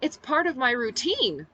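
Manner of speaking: high, chirpy talk through clenched teeth; sweet on top, tense underneath; bright forced smile. A narrator's reading. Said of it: "I smiled brightly through clenched teeth".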